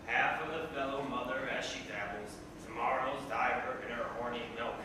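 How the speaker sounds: usual speaking voice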